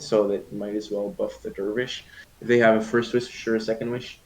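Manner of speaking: lively play-by-play casting; esports caster. Acted. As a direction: call the game like a caster. Caster calling the match.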